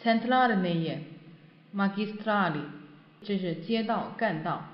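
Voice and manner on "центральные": Центральные with a strong, stressed а. Shown as a demonstration. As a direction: lean on the second syllable of Центральные. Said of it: "啊上面".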